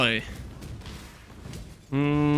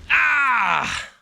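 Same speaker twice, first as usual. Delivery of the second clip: a short, sharp yell of frustration, higher; frustrated yell; 10 semitones higher than usual. Real, not acted. Streamer yelling after getting baited by an item, frustrated yell. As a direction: let out a sudden yell at being baited.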